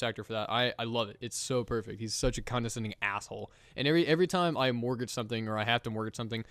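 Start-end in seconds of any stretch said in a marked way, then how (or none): none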